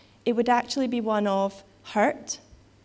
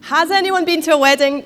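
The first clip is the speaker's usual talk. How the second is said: higher, louder call to the room; louder repeat; 6 semitones higher, 10 dB louder than usual. Real, not acted. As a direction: call it out again so the back rows answer. Repeats question to get response.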